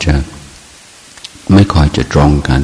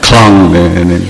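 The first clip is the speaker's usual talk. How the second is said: laughing; 2 semitones higher, 4 dB louder than usual. Real, not acted. Laughing at own fluency.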